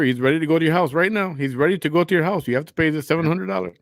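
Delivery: mock-urgent phone threat, rattled off; urgent scammer act. Imitating a scammer demanding seven hundred dollars, urgent.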